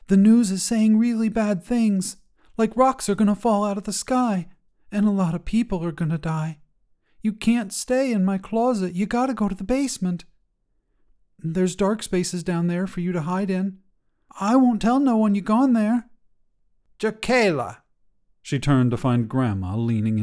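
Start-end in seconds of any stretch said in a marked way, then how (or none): 0.1-16.1 put-on higher, bright child voice, urgent and pleading; a young girl begging her closet monster to hide; worried kid voice, pushing hard to be believed; earnest little girl. 17.0-17.8 put-on grandmother calling a child's name from the doorway; higher call of Jacayla with a falling end; sharp call to get her attention.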